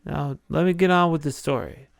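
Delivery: ordinary talk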